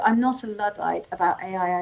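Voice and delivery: normal talking voice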